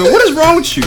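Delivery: normal talking voice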